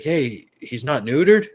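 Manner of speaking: loud, high, drawn-out question; challenging stranger. Retold or imitated. Stranger questioning owner about neutering, loud mock disbelief. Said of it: "He's like".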